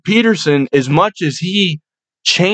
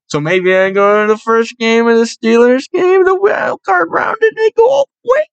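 excited hype; 8 semitones higher than usual. Real, not acted. Fan to camera dreaming of a run, rising excitement. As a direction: voice climbing high and quick with excitement. Hyping a possible Steelers playoff run.

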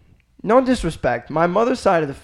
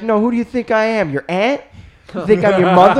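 higher, louder mocking jab; teasing dad; 8 semitones higher, 7 dB louder than usual. Dad ribbing his son about the aunts' throwing, mocking. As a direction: tease him for throwing like his mom. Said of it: "Be like".

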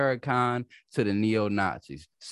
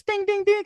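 high, loud ringing dings; imitated glass chime; 6 dB louder than usual. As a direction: ring out like a tapped glass.

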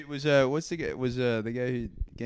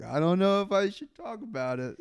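higher-pitched imitation of a hesitant friend; mock reluctance; 7 semitones higher than usual. Teasing a friend for dodging the description, mock reluctance. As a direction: play up coy hesitation, pitched high.

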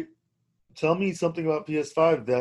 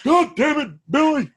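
high mock hillbilly Southern scolding; older hillbilly; 10 semitones higher, 4 dB louder than usual. Hillbilly scolding Billy, mock Southern accent. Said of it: "like I'm an older hillbilly".

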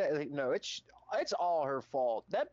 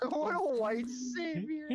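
laughing; 10 semitones higher than usual. Real, not acted. Repeating the mock praise laughing.

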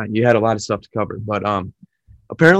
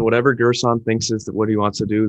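ordinary delivery

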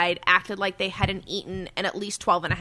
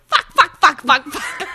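high, loud rapid-fire swearing; gleeful little girl; 6 semitones higher, 9 dB louder, slower than usual. Retold or imitated. Daughter joining in the backseat swearing, high loud gleeful chant. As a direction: imitate a kid chanting a naughty word, loudly.

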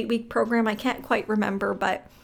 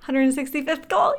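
higher, thrilled repeat; excited; 4 semitones higher than usual. Retold or imitated. Reliving the first sale after many calls, excited. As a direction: burst into excitement at the milestone.

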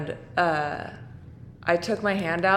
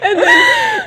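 much higher and louder, laughing; shouting through laughter; 7 dB louder than usual. Real, not acted. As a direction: burst out laughing mid-sentence. Laughing outburst mid-story.

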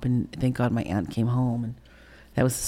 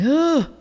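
high loud disgusted groan; disgusted ugh; 5 dB louder than usual. Retold or imitated. Disgusted reaction.

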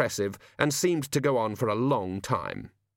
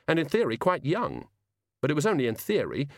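ordinary delivery, nothing marked

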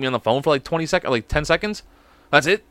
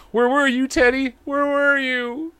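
high, drawn-out wail, slower on the last ask; mock pleading; 11 semitones higher, slower than usual. Host calling out to the fake ad man, hammed-up high plea. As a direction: cry out like a dramatic lost lover. Mock longing cry to Teddy.

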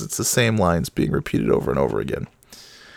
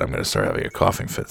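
low strained voice; 9 semitones lower, faster than usual. Real, not acted. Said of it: "if I talk like that".

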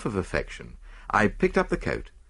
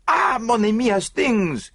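put-on high, loud French-accented greeting; delighted cry; 5 dB louder than usual. A narrator's reading. Detective springs to meet his friend, high delighted cry. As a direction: cry out joyfully to an old friend. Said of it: "he cried".

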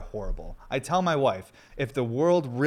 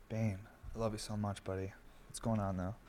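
soft talk to dog; 11 dB quieter than usual. Real, not acted. Cooing to his dog.

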